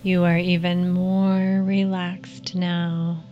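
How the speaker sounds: calm them down gently, unhurried. Acted. Leads a guided meditation.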